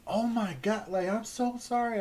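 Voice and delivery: high, loud gasping sympathy; shocked HR staff. Retold or imitated. HR people hearing of his brother's death, high shocked sympathy. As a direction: squeal it out like a stunned coworker.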